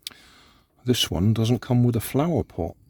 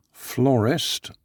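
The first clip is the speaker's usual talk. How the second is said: ordinary talk, nothing marked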